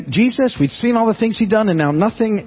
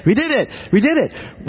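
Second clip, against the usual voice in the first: whooping, cheering victory cry; high-five the angels and cheer; a pretend Jesus whooping over the win; celebrating Jesus; 8 semitones higher than usual. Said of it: "if I were Jesus, I'd probably be hanging out and high-fiving angels".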